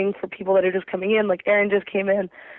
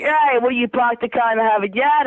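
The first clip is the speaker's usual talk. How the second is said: mock Boston accent with dropped r's; 3 semitones higher than usual. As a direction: imitate a stock Boston accent. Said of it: "No, that's Boston".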